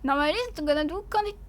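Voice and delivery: ordinary talk, nothing marked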